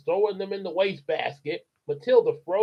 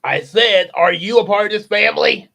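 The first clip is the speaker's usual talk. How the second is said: louder insistent question; loud repeated demand; 4 semitones higher, 8 dB louder than usual. Acted. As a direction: hammer the question home.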